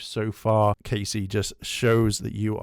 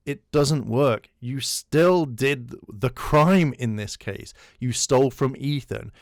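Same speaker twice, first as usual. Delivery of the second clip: firm, deliberate rebuttal; slow and firm; 4 dB louder than usual. Real, not acted. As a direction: lay it down slowly.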